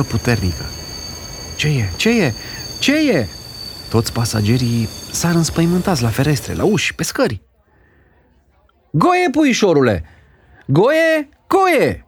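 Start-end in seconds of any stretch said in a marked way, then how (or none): 1.6-3.3 short questions climbing in pitch; rising alarm. Acted. Passengers after the train suddenly stops, rising alarm. 8.9-12.0 put-on high, frantic calls for a child; shouted call. A narrator's reading. Aunt calling the boy after the sudden stop, shouted call. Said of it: "strigă tanti Mița".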